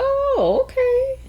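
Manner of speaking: a high, soft mock mom caught off guard; surprised mom. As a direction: squeak it out like a startled mother. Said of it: "She was like".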